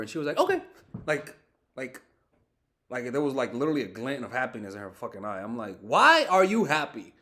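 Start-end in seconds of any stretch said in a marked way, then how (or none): 0.4-0.6 imitating her happy reply. Girlfriend told she can't sleep over, mock happy. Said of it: "she sounded happy". 5.8-7.1 loud, higher, drawn-out disbelief; incredulous. Retold or imitated. Boyfriend questioning her happiness, retold incredulity. Retelling his baffled reaction to her.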